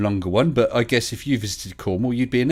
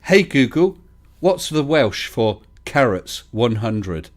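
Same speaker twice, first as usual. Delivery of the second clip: slow, deliberate command, louder at the start; voice-assistant command; 2 semitones higher, 4 dB louder, slower than usual. Pretend. Host mimicking a question to Google, deliberate command. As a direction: spell it out for a smart speaker.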